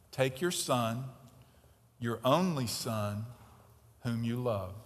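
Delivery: low, hushed reading of the verse; softly quoted. Shown as a demonstration. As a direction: read it gently, almost to yourself. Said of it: "What does that sound like?".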